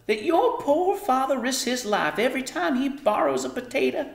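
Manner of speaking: high, solemn mother voice; grave Homily. A narrator's reading. Mother warning daughter about father's danger, grave high voice. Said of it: "went on gravely".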